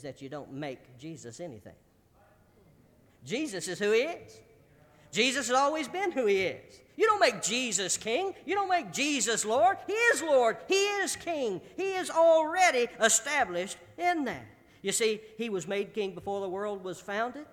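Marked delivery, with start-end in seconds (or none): 3.2-14.4 rising from firm to louder, higher preaching; building sermon climax. Acted. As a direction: build each line to a ringing peak. Preacher rising to full force.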